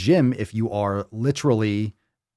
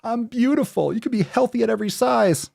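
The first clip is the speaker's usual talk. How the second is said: high-pitched mock protest of women justifying themselves; 13 semitones higher than usual. Mimicking women justifying being fat, mocking high voice. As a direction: imitate indignant women, voice pushed way up high. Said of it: "Women are trying to justify it".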